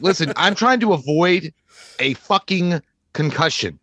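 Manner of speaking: slow, deliberate mock warning; measured warning. Man warning his girl to stop provoking, slow measured warning. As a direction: space the words out with pointed pauses.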